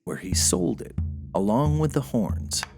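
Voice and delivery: plain reading voice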